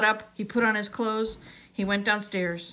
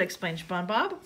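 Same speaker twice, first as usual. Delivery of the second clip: usual speaking voice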